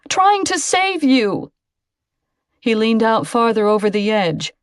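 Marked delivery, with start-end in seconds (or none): 0.1-1.5 higher, slow, dripping sarcasm; slow sarcastic jab, pitched up. Acted. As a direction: drawl it out, mocking her worry. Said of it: "Lucy heard the sarcasm in his voice".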